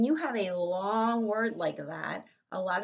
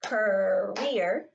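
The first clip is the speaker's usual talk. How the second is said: career louder, split into two beats; clapped syllables; 7 dB louder than usual. Shown as a demonstration. Teacher clapping career for the class, louder and split. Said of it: "if we clap it".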